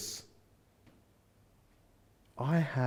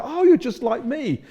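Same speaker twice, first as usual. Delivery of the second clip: delighted recognition; 9 semitones higher, 4 dB louder than usual. Retold or imitated. Finding common ground at a barbecue.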